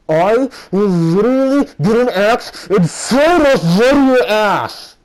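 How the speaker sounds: very high, loud, slow yelled threat; furious threat. Real, not acted. Speaker yelling at the creep watching, furious and slow. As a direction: scream it at him, drawn out. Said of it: "hey dude, if you're watching this video".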